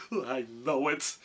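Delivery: slow, lightly voiced sorry lion; ashamed lion. A narrator's reading. Lion admits cowardice to Dorothy, slow ashamed character voice. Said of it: "hanging his head in shame".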